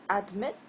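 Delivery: adMIT, rising on MIT; stress on second syllable. Shown as a demonstration. Teacher quizzes learners on stress, adMIT stressed on the second part. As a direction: hit the MIT of admit as a question. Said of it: "So it's admit, not admit".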